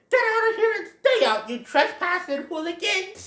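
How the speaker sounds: put-on high, loud cranky old man voice; Old Man Jenkins. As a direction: scold trespassers off your land. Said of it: "he's my old man Jenkins".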